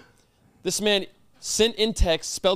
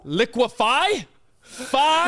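"Liquefy?" very slow, louder and higher, leaning on Fi; stressed slow demo; 6 semitones higher, 3 dB louder, much slower than usual. Shown as a demonstration. Quizzing host hammering the F sound, slow and pointed. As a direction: draw out "Liquefy?" and hit "Fi?" high and loud. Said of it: "You thought it was a P H".

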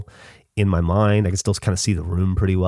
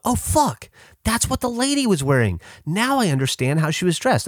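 high-pitched astonished outburst; excited reenacted "oh, now I get it"; sudden realisation; 11 semitones higher than usual. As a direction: blurt it out high, as if it just clicked. Acting out a later sudden realisation.

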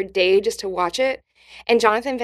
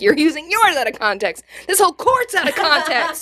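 mock retort; 7 semitones higher, 5 dB louder than usual. Arguing with troll online.

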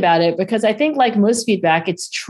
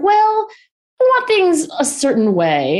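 tactful reluctance; 11 semitones higher than usual. Retold or imitated. Retold husband hesitantly giving feedback.

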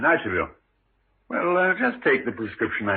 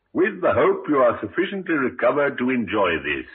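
ordinary delivery

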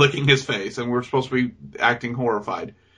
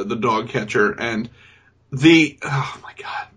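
normal talking voice

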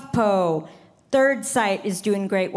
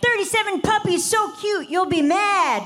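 clickbait headline voice; 8 semitones higher than usual. Pretend.

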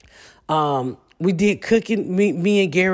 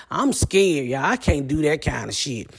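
ordinary talk

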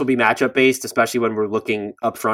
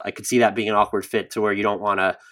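ordinary talk, nothing marked

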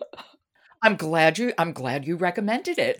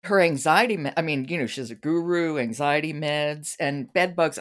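usual speaking voice

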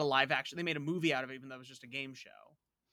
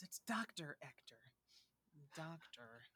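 a hushed quoted catchphrase; quoting movie line; 17 dB quieter than usual. Retold or imitated. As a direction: mimic the film delivery quietly. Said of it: "she goes".

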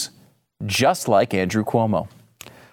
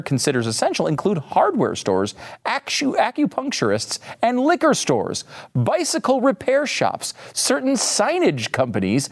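higher, mock-grand courtroom reading; reading the opinion aloud; 4 semitones higher than usual. Host reading Gorsuch's opinion to his audience, relishing it. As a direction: read it like a judge delivering a burn. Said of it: "give you a chunk of the concurring opinion".

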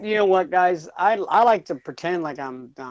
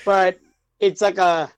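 struggling for words; 2 semitones higher, 4 dB louder than usual. Real, not acted. Teased for sounding smart, flustered and halting. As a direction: stop and start, pushing words out. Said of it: "your kid trying to tell you something".

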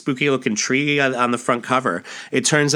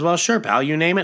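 folksy salesman; 7 semitones higher than usual. Acted. McKay agrees cheerfully.

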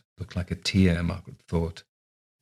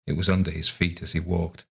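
ordinary delivery

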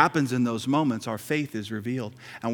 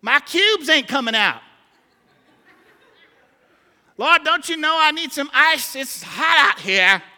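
much higher and louder, mock-desperate whine; whiny complainer; 7 dB louder than usual. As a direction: whine about the broken fridge like it's the end of the world. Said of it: "we're like".

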